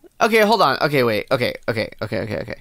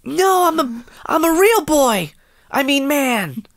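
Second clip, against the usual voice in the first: high cartoon boy voice; high cute anime voice; 9 semitones higher than usual. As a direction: squeak it like an anime kid. Playful cartoon role-play.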